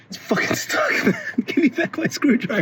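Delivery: high breathless laugh through the words; laughing in disbelief. Real, not acted. A man stuck on a screw laughs at himself in disbelief.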